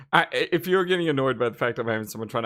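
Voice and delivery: ordinary talk, nothing marked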